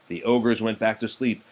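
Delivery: ordinary delivery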